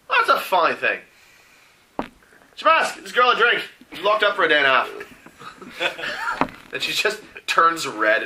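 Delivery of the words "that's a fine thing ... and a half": raised, high-pitched character voice; hearty drink order. Acted. Mara orders Shamask to serve the girl, loud hearty call. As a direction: call across the bar for service.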